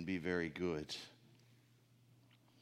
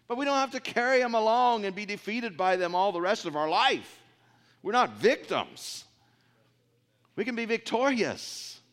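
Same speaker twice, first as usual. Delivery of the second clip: loud, high preaching voice; 13 semitones higher, 9 dB louder than usual. Acted. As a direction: preach it out to the congregation. Preaching at full force.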